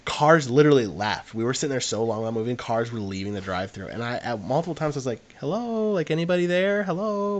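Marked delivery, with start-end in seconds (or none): 5.4-7.4 high, singsong retold hello; pitched-up, questioning call for attention. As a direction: call into an empty speaker box. Retold calling into silent speaker box.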